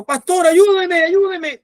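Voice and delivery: high, loud, drawn-out cry for help; desperate plea. Retold or imitated. Dying boy begging the pastor for help, desperate plea. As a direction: imitate a frantic plea for rescue.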